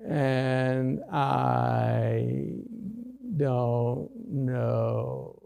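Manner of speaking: soft, dazed, slurred mumbling; hospital patient trying to explain symptoms, mumbling; confused mumble. Retold or imitated. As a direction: mumble like a patient who can't think straight. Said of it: "the doctor thinks you sound like this".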